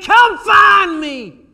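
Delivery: crying out to God. Retold or imitated. Psalmist crying out to God: slow, loud, high shout. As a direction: cry it out slowly, begging. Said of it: "he's crying out to God".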